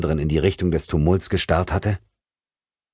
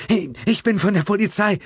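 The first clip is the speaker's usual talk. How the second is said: a higher, urgent policewoman voice; lightly voiced policewoman. A narrator's reading. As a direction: reassure the victim from above, urgently. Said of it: "wiederholte sie".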